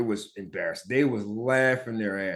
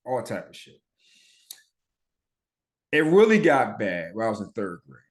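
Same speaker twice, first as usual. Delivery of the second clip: usual speaking voice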